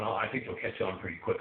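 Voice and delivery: ordinary talk, nothing marked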